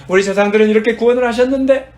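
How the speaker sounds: loud, high voiced complaint to God; imitated indignant plea. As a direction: argue it upward at full voice. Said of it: "[name]이 지금 그렇게 하고 있어요".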